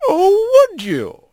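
put-on high, knowing old lady voice; grandma playfully doubting the kids; amused grandma. A narrator's reading. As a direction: tease them fondly.

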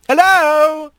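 high sing-out hello; bright-pitched interrupting call. Acted. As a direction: call to them in a high fox voice. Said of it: "the fox's voice called".

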